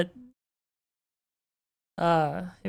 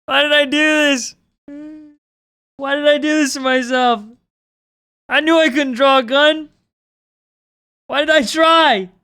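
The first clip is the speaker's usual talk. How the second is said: loud, raised-pitch lament; exasperated outburst; 5 semitones higher, 6 dB louder than usual. Real, not acted. Artist despairing over a badly drawn gun, exasperated outburst. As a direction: wail in frustration at your own work.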